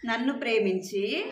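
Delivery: put-on drawn-out call for the kids to echo; teacher leading the verse slowly; slow lead line. Shown as a demonstration. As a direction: stretch every syllable for the children. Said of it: "అందరూ పలకాలి".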